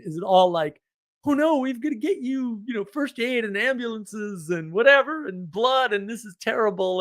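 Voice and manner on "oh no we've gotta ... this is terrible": mock panic. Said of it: "flips out in the other direction".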